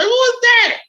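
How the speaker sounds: mother questioning her son about the caller; suspicious mother. Retold or imitated. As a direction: voice the mother asking sharply. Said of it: "my mother was like".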